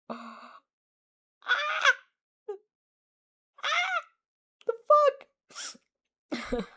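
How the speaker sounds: high, loud yelp of surprise; startled shriek. Real, not acted. Streamer startled by the story, shrieking. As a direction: jump at something. Startled reaction mid-reading.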